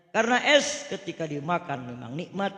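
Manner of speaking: ordinary talk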